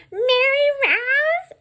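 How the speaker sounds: very high, loud, slow call; drawn-out falsetto mimicry; mocked supervisor. Supervisor phoning the mail carrier from the office, mocking high screech. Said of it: "and she's like".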